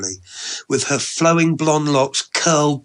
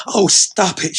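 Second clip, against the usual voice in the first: higher, louder flustered woman's voice; bashful protest; 7 semitones higher, 5 dB louder than usual. Acted. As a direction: playfully brush off the compliment. Flattered woman playfully protests.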